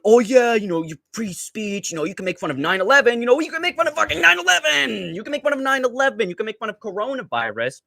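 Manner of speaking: high, fast mocking voice; high mocking sneer; mocking conservatives. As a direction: parody a smug conservative. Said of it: "the fucking conservatives being like".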